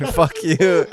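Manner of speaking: laughing fuck you; real laugh, playful. Real, not acted. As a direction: swear at him while cracking up. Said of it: "and I'm laughing".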